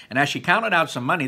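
ordinary delivery, nothing marked

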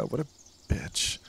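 unvoiced, soft whisper; whispered insult. Real, not acted. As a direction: breathe it out behind her back. Muttering about Sophia.

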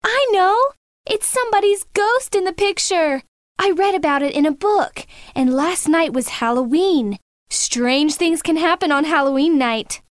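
put-on very high girl's voice, excited discovery; crying out. A narrator's reading. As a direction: blurt out a spooky theory in high pitch. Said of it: "and then Michelle cried out".

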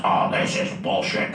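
low, slow disgusted grumble; mock-furious, dragged-out growl; furious kid. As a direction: imitate a fuming boy giving up. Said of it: "now he's just furious".

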